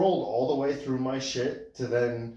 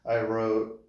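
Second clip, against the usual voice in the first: normal talking voice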